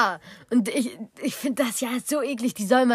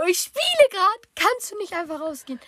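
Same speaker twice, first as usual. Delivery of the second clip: annoyed retort; 9 semitones higher than usual. Retold or imitated. Annoyed teen to dad, high fed-up whine. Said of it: "Ich denk mir so".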